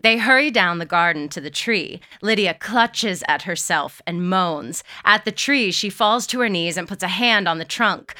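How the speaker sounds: ordinary delivery, nothing marked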